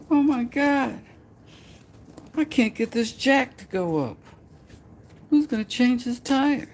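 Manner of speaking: very high, helpless falsetto damsel voice; helpless lady. Pretend. Helpless lady asking for someone strong, high falsetto.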